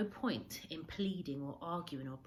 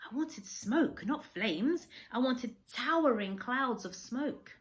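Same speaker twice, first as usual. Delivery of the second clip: frustrated longing; 7 semitones higher, 7 dB louder, slower than usual. A narrator's reading.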